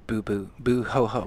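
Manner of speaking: low fake crying boo hoo; hammed-up sob sounds; mock boo-hoo. Mock booing Glazer.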